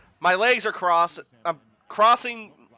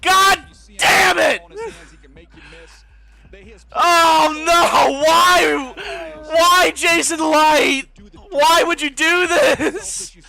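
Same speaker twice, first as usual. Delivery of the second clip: slow, loud, anguished crying out; despairing shout; 8 semitones higher, 5 dB louder, slower than usual. Real, not acted. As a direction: wail at the team, heartbroken. Despair over the pick.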